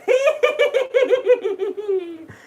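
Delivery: loud villainous laugh; high cackle. Acted. Laugh after sign-off.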